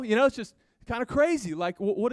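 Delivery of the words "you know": ordinary talk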